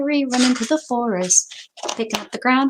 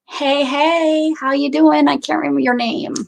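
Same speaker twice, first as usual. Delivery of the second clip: excited greeting; 7 semitones higher, 6 dB louder than usual. Real, not acted. Greeting someone who walks in.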